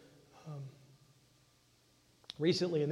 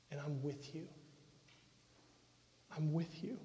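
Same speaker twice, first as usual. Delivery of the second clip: gentle Jesus voice; 8 dB quieter than usual. Acted. Jesus speaking tenderly to someone suffering, soft and comforting. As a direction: hush down and comfort someone who is hurting.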